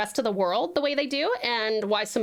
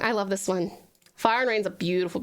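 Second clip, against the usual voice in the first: usual speaking voice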